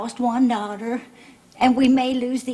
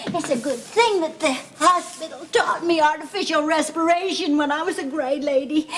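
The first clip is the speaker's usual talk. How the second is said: ordinary talk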